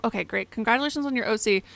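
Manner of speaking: ordinary talk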